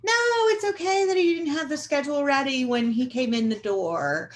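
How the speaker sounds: hammed-up, high-pitched 'no, it's okay'; mock reassurance, overly forgiving; falsely reassuring. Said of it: "being unkind when you say".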